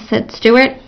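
usual speaking voice

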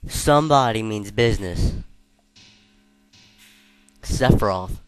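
excited. Real, not acted. Thrilled by the villain's return.

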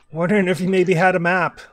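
half-shouted request across the distance; shouting from afar. Acted. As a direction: project it to someone far off. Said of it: "shouts loudly".

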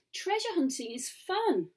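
high, eager complaint; childish protest. Acted. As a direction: insist like a reluctant child. Boy protests leaving.

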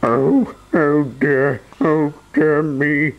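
high slow wailing oh dear. Acted. Mister Mean mourning his money, high slow wail. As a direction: wail over lost money. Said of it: "he wailed".

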